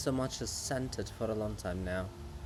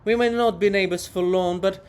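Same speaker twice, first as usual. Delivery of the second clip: much louder, indignant calling out; 3 semitones higher, 7 dB louder than usual. Acted. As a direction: shout across to be heard. Monument shouts at Hohol.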